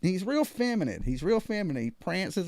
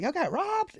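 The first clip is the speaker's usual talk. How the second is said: 1 shocked question; 12 semitones higher, slower than usual. Retold or imitated. His surprised question retold.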